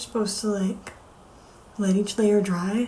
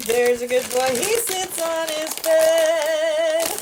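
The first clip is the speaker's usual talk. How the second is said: high, slow sing-song for the dog; sing-song dog talk; 6 semitones higher, much slower than usual. Real, not acted. Owner cooing tricks to her dog Bear, high sing-song. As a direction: coo each word slowly at the puppy.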